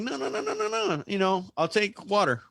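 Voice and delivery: very high, rapid string of no-nos; retold hasty refusal. Customer insisting water is fine, in a high hasty refusal. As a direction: decline in a high mock fluster, then settle.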